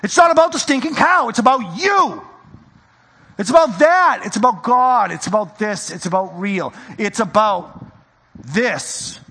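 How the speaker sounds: loud, urgent preaching as Jesus; Jesus pleading. Acted. Jesus correcting the people, loud and urgent. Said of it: "Jesus came and said".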